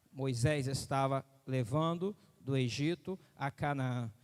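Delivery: ordinary delivery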